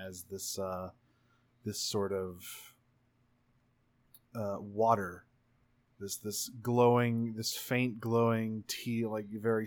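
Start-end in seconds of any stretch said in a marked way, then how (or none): none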